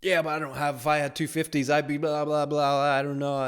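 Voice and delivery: high-pitched mocking excuse-maker; mock whining objection. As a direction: imitate someone making feeble excuses, trailing into blah-blah. Mimicking an objector making excuses.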